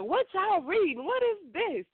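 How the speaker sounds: high, suspicious teacher question; teacher's voice. Retold or imitated. Science teacher catching the students, suspicious. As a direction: imitate a nosy teacher. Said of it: "our science teacher".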